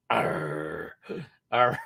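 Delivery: low mock pirate arr; pirate growl. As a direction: toss off a playful pirate arr. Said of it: "National Talk Like a Pirate Day".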